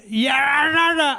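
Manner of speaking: drawn-out garbled mock yell; mocking holler with no real words; imitated wailing. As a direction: imitate a distant ranter with a long wordless wail. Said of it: "I'm hearing this".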